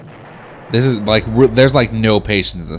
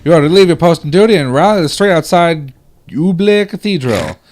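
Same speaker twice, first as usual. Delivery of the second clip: higher, louder British army officer; British accent; 7 semitones higher, 5 dB louder than usual. Acted. Army note orders Private Thompson to rally, hammed-up British accent. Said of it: "I talked in a British accent".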